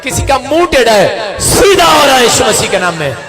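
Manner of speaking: loud, emphatic revival-meeting proclamation; prophetic healing preaching. Real, not acted. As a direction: announce each healing with full-voiced authority. Calls out healings to congregation. (जोशीली भविष्यवाणी वाला प्रचार)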